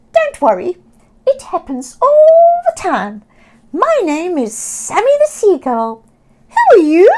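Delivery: high, loud cheerful squawking bird; friendly seagull. Acted. Sammy the Seagull greeting Oscar, high cheerful squawk. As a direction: chirp it brightly like a friendly gull.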